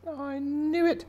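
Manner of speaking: very high, delighted fish squeal; self-satisfied squeal. Acted. Angelfish hearing he is the fairest, self-satisfied squeal. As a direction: squeak it out with smug glee.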